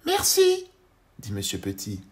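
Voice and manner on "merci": very high, louder little voice. Acted. Tiny man greets the worm, very high voice.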